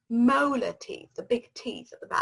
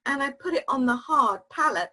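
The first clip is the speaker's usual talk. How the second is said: usual speaking voice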